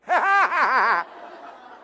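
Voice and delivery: gleeful high cackle; mean cackle. Acted. Peeves laughing at Myrtle.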